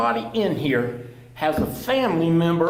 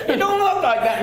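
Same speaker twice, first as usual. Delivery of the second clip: higher, louder, laughing through the words; laughing quote; 10 semitones higher, 8 dB louder than usual. Retold or imitated. Quoting counselor Caitlin to the room, laughing. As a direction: mimic the counselor while cracking up.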